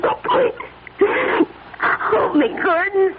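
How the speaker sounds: high, crying plea; sobbing plea. Acted. Wife begging her husband to hold her, crying high. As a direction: beg through tears.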